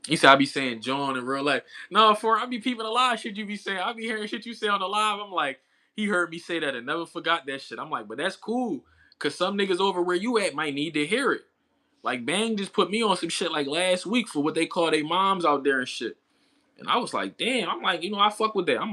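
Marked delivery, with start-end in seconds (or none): none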